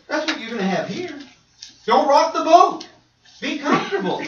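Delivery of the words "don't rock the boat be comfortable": mock complacency. Complacent churchgoer to the congregation, mocked. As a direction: parody someone who wants no trouble.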